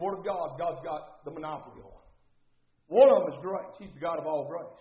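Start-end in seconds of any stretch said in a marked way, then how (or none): none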